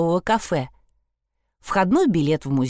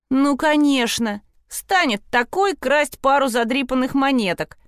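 mocking; 6 semitones higher than usual. Sprotte mocking Trude's criminal theory, sarcastic. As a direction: scoff at the idea. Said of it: "насмешливо сказала Шпрота".

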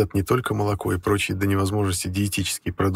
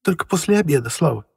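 higher firm mother's voice; mother cutting in; 8 semitones higher, 4 dB louder than usual. A narrator's reading. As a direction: interrupt gently but firmly. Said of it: "вмешалась мать".

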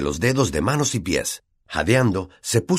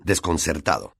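usual speaking voice

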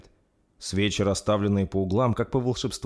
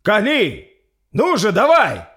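very high, loud yell; frantic full-voiced shout; shouted order; 13 semitones higher, 11 dB louder, slower than usual. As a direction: scream the command across a fight. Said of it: "закричал капитан".

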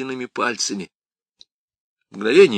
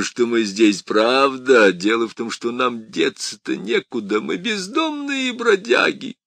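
mock him like a gleeful devil; taunting demon; 3 semitones higher, 4 dB louder, slower than usual. Demon mocks priest.

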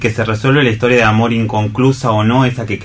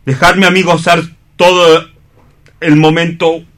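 higher and louder, with swelling emotion; emotional outburst; 7 semitones higher, 5 dB louder than usual. Acted. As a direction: let the feeling burst out. Juan's emotional peak.

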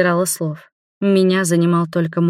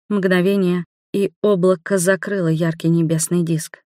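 ordinary delivery, nothing marked

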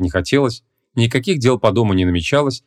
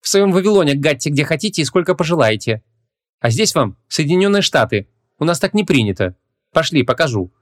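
put-on higher-pitched young woman objecting; higher voice, indignant objection; Ванесса, indignant heroine; 6 semitones higher than usual. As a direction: protest in a raised female character voice. Indignant heroine scolds the guests.

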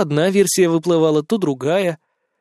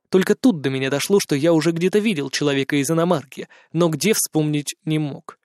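ordinary delivery, nothing marked

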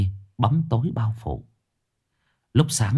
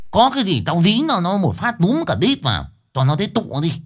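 brash and loud, pitched up; swaggering bluster; 8 semitones higher, 3 dB louder than usual. Acted. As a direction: puff yourself up, boast loudly. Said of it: "nó hùng hổ". (Chiến khoe khoang với bác Thành, giọng hùng hổ to cao)

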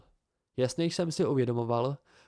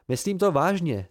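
higher, louder insistence; insistent; 5 semitones higher, 5 dB louder than usual. A narrator's reading. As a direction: stand your ground, in earnest. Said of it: "trval jsem na svém".

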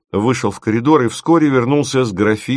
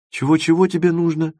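high-pitched, puzzled double-take; incredulous asking back; 8 semitones higher than usual. A narrator's reading. Timofeev asks Dima what he needs, incredulous. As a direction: ask back as if misheard. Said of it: "переспросил Тимофеев".

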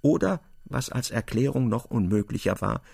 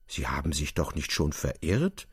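mild surprise; 9 semitones lower, 4 dB quieter than usual. A narrator's reading. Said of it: "fragte der Gerichtsdiener erstaunt".

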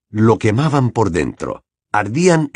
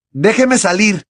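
higher, more desperate repeat; demanding louder; 10 semitones higher, 3 dB louder than usual. A narrator's reading. Harry repeats his demand to the headmaster, more desperate. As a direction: push the demand harder. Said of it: "repitió Harry".

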